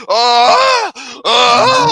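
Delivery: loud imitated wails; hammed-up communal moaning; mocking orgasmic wail. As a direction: wail along to make fun of her. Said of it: "wailing with her".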